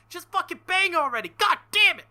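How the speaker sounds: frustrated shout. Real, not acted. Frustrated outburst at slow scene.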